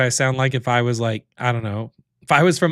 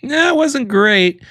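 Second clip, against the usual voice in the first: dismayed reaction; 11 semitones higher, 5 dB louder, slower than usual. Real, not acted. Reacting to bad AI voice clip.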